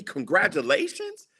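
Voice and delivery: incredulous echo. Retold or imitated. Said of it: "and you tell me".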